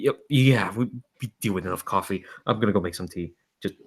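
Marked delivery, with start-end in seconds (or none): none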